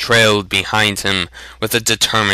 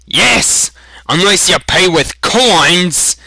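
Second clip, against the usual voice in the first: loud, high angry retort; growled; 8 semitones higher, 5 dB louder than usual. Acted. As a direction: snarl the condition. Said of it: "Sloane growled".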